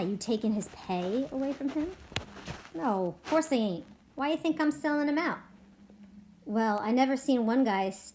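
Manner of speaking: lively read-aloud with voiced ranch characters; story narration. A narrator's reading. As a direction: read it to the class like a story, giving each man a voice. Reading resumes, boss and George.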